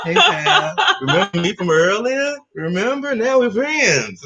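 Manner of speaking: high, laughing mock cat voice, slow at the end; cat's voice. Cat sucking up to its owner at night, laughing high voice. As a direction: play the smug cat sweet-talking its owner.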